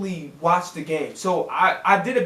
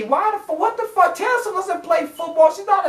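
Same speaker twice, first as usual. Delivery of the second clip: pitched way up in disbelief; exasperated outburst; 9 semitones higher than usual. Real, not acted. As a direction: let the frustration jump out high. Ranting about NFL [name] coverage.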